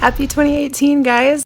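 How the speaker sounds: hoarse sick throat; croaky voice from a sore throat; frog voice. Real, not acted. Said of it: "that's why I sound like a frog".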